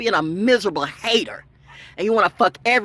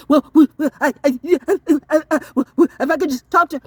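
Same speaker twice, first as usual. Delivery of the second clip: imitating stammering pleader; 5 semitones higher, 5 dB louder, faster than usual. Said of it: "the way you try to do this".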